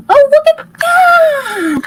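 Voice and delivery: high, loud delighted cry; excited shout. Real, not acted. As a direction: burst out in surprise. Reacts to something on screen.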